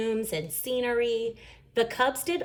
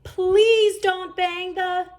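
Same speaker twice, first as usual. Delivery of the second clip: much higher, loud, slow plea; pleading cry; 11 semitones higher, 6 dB louder, much slower than usual. A narrator's reading. Brother crying out before Papa bangs the door. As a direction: call out to stop him. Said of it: "Brother cried".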